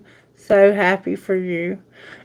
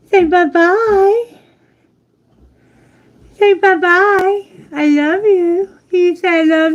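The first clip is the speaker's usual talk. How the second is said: sing-song coaxing to the bird; 8 semitones higher than usual. Real, not acted. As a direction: coax the bird to talk, sing-song high.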